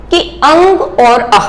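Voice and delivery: "अंग और आह" said slowly, each word drawn out; slow sound demo. Shown as a demonstration. Teacher reminding students of earlier sounds, slow. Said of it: "जैसा मैंने आपको बताया था".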